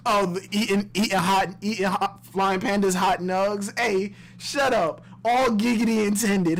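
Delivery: ordinary talk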